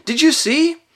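high, loud, breathless-with-excitement question; thrilled, rising question; excited cry. A narrator's reading. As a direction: ask him eagerly, pitched right up. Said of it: "cried Edmund".

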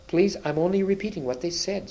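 high, frightened pleading; stammered plea. A narrator's reading. Edmund excusing himself to the queen, frightened stammer. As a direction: plead innocence in a high, anxious voice. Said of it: "stammered Edmund".

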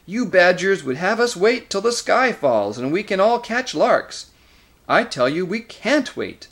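high, loud, exasperated outburst; angry snarl. A narrator's reading. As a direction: throw your impatience at the badgers. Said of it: "snarled Nickabrick".

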